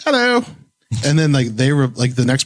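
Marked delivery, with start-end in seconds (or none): 0.0-0.4 much higher, hammed-up British hello; tossing back a jokey British-accent hello; mock British accent. Greeting his UK teammates in a mock British accent. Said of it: "like, shitty British ex-, expressions".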